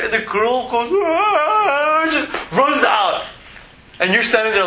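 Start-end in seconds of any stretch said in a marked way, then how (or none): 0.9-2.1 mock wail. Bride crying out, running off.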